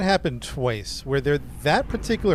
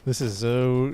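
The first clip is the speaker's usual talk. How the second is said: ordinary delivery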